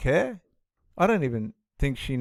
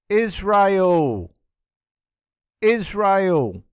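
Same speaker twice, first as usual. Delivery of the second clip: loud, high, falling cry; exasperated exclamation; 5 semitones higher, 4 dB louder, much slower than usual. Real, not acted. Commentator giving viewers the obvious answer, exasperated exclamation. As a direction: hammer the answer home to the viewers.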